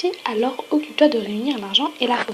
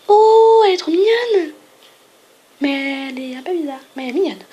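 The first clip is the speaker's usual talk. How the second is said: ordinary delivery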